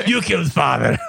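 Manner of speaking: laughing fake Russian accent; put-on Russian accent; mock Russian accent. Shown as a demonstration. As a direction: repeat it in a Russian accent, amused. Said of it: "Russian if possible".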